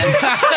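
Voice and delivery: sudden high, loud burst of laughter. Real, not acted. Laughing as she retells the woman's joke to friends. Laughing after the funny reply.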